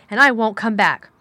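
loud, final refusal; emphatic, defiant vow; angry parting shot. A narrator's reading. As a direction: fling it back forcefully on leaving.

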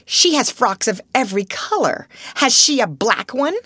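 sharp, rising impatience; impatient. A narrator's reading. Miss Minchin to her sister, impatient. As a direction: press her hard.

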